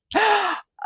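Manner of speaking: short high rueful laugh; sudden high laugh; embarrassed laugh. Real, not acted. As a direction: laugh at yourself, a little ashamed. Laughs at her own behaviour.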